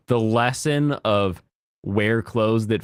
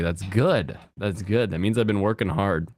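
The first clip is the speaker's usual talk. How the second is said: normal talking voice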